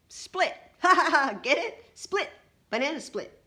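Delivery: high, loud joke punchline with a hearty laugh; hammed-up punchline. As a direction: crack up at your own joke. Uncle laughs at own punchline.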